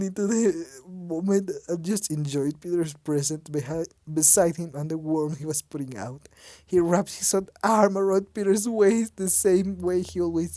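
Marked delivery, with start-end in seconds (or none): none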